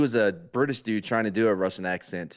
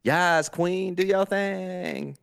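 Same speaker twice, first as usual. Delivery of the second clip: high, drawn-out sassy cheer; mock-fabulous egging on; hype cheer; 8 semitones higher, much slower than usual. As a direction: imitate a gushing hype friend, stretched out high. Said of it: "And then it's like".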